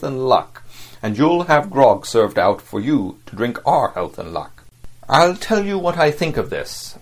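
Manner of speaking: a stern captain calling out the crew's good work; captain addressing crew. Acted. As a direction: raise your pitch and project to the whole deck.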